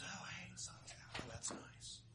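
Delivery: put-on very soft, whispery old man; quiet pleased murmur. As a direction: murmur it under your breath, pleasantly relieved. Old man notices the sunshine.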